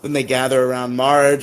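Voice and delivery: normal talking voice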